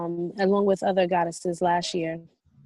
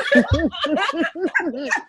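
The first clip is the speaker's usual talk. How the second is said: witch cackle; 10 semitones higher, 3 dB louder than usual. Pretend. Copying the witch laugh.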